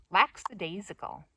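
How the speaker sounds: "Lackadaisical" with an S after the K; hissed X in the first syllable of "Lackadaisical"; mistaken pronunciation. Shown as a demonstration. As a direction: say "Lackadaisical" as lax-a-DAISical. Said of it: "That's the way I've been saying it".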